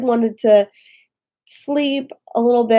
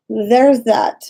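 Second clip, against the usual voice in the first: ordinary delivery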